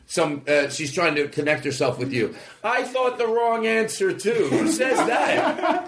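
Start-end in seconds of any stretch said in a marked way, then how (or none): 2.6-5.9 slow words breaking into laughter; cracking up at her copycat answer; laughing. Real, not acted. Laughing at friend's remark.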